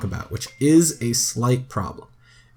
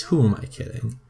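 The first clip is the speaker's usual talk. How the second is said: ordinary talk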